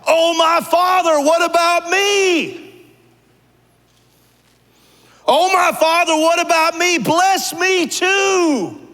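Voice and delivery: high, loud, slow wailing plea; bitter cry. Acted. Esau begging his father for a blessing, wailing high. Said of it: "He let out a loud and bitter cry".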